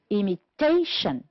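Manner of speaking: "Imitation" stressed on TA, then falling; stress on third syllable. Shown as a demonstration. Teacher correcting an exercise, third syllable of "Imitation" stressed. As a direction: lean on "ta" in "Imitation". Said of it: "stressed on the third syllable".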